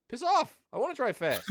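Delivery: high, defiant snap back; defiant retort. Retold or imitated. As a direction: brush them off, annoyed. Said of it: "And I'm like".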